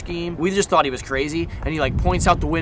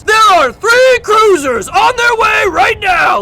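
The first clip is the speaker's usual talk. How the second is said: slow, high, full-volume mock bellow; loud, over-the-top shouting lady impression; imitated yelling; 11 dB louder, much slower than usual. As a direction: bark orders like an army sergeant, stretched out. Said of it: "said it just like this".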